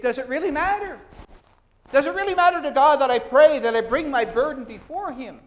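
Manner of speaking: plaintive question. Retold or imitated. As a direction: voice the doubting believer, pleading loudly. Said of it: "the question is articulated this way".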